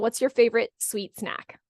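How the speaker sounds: usual speaking voice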